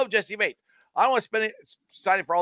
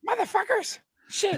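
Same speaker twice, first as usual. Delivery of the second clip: squeaky puppet voice; 13 semitones higher, 3 dB quieter than usual. Retold or imitated. Continuing high squeaky voice.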